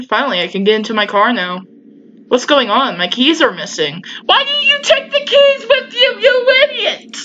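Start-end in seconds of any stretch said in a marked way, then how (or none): 4.3-7.2 exasperated yell. Real, not acted. Gamer yelling at his character about the lost keys, exasperated high yell. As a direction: scold him for leaving the keys, exasperated.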